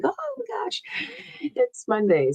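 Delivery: high squeaky panic, then settling; panicked squeal. Retold or imitated. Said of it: "being like".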